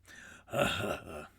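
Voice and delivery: a hearty cockney guffaw from afar; cockney laugh. Acted. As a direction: laugh like a rough London geezer. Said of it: "you hear Ray Winestone laughing".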